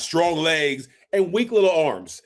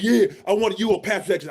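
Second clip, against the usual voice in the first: raised, forceful player act; put-on confident persona; 7 semitones higher, faster than usual. Nervous prospect playing a role to scouts, put-on confidence. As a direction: sell the role to hide nerves. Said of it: "playing a role".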